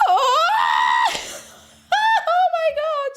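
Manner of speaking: high-pitched shouted exclamation; high, gasping fangirl cry; overwhelmed squeal. Real, not acted. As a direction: squeal it out in disbelief. Overwhelmed by surprise new song.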